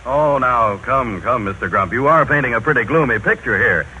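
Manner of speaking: ordinary talk, nothing marked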